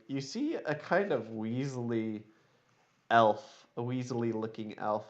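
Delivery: ordinary delivery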